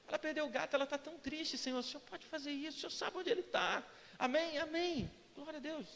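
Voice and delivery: soft, conversational chat with God; intimate casual prayer. Retold or imitated. Driver praying for Carol's lost cat, intimate casual prayer. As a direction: pray quietly, as if talking to your dad. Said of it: "muitas vezes eu falo".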